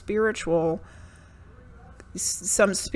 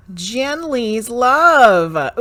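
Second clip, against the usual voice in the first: drawn-out announcement; 4 semitones higher, 9 dB louder, much slower than usual. Real, not acted. Reader calls out the next viewer's request, slow and loud. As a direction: draw the name out slowly and loudly.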